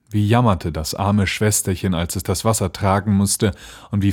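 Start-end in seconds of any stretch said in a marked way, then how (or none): none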